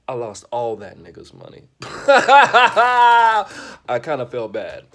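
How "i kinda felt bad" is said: said through a big laugh, high and loud. Real, not acted. Comedian to audience, laughing through the line. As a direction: laugh it out at your own story.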